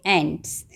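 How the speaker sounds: एंट्स said low with a short "a" as in cat; a short, falling एंट्स for the kids to copy; model pronunciation. Shown as a demonstration. Teacher prompting children to repeat एंट्स correctly. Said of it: "आप कैसे बोलेंगे?".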